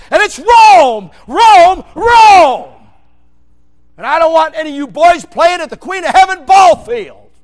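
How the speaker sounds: loud, high full-force sermon shouting; fiery preaching. Acted. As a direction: thunder it from the pulpit.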